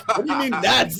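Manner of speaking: imitated bewildered comeback; baffled challenge. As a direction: act out being thrown by the remark. Baffled reply to boss, retold.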